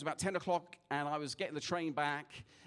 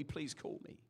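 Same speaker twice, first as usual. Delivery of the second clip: plea dropping almost to a whisper; hushed pleading; 9 dB quieter, slower than usual. Retold or imitated. Preacher leaving a crying stranger, softly pleading. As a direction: let the last plea fall away softly.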